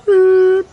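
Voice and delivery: a sharp high beep noise. Shown as a demonstration. Marking a sudden departure with a high beep noise. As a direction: squeak out a machine beep. Sound effect in the story.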